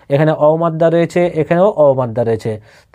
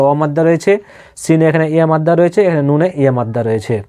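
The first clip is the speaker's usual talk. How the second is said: normal talking voice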